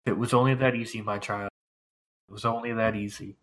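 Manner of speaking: soft, sighing priest. Acted. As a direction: say it like a weary old priest. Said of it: "he's like".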